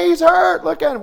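high, loud alarmed shout; the mother shrieking that he's hurt; panicked yell. Retold or imitated. Mother rushing in to her boy, loud panicked yelling. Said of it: "she comes running in there".

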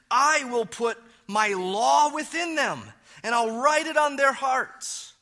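preaching at full force. Acted. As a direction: preach it out loud and high. Preaches God's promise.

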